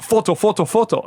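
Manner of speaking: high, insistent mock fan calling; lightly imitating a fan pestering for a photo; pushy fan. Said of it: "And he's like".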